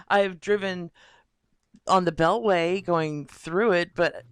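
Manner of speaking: ordinary talk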